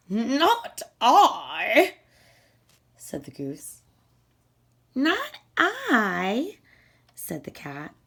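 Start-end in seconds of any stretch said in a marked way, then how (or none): 0.1-1.9 loud, brisk goose voice refusing; a lazy goose brushing off the hen. Acted. 4.9-6.6 higher, airy cat voice; a vain cat waving off the request; vain cat refusing. Acted.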